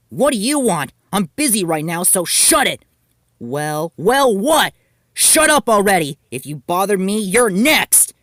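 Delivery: furious, loud brush-off; angry snapping. Acted. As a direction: snap at him to butt out. Nibutani snaps at interrupting Yuta.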